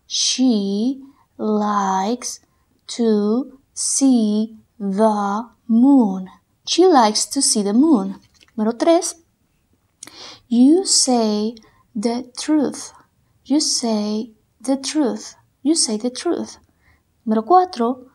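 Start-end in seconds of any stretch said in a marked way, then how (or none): 0.1-6.3 every word drawn out slowly; very slow dictation. Shown as a demonstration. Teacher dictating slowly so students can write. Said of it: "Trata de escribirlos de acuerdo como los escuches". 10.5-15.4 slow, word by word; very slow dictation. Shown as a demonstration. Teacher dictating slowly to the class. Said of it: "Trata de escribirlos de acuerdo como los escuches".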